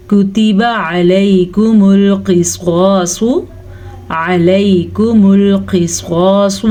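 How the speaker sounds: slow, drawn-out Quran recitation; tajweed recitation. Shown as a demonstration. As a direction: chant it slowly, stretching the vowels. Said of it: "قاف موٹی ہے تو موٹی پڑھیں گے". (تجوید کے ساتھ آہستہ تلاوت)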